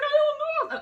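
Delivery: very high, loud acted wail; retold crying. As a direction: squeal it out in mock tears. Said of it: "I was just crying".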